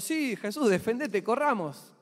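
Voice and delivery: high, acted rallying cry; urgent cheering-on. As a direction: egg him on to fight and flee. Acts out disciples urging escape.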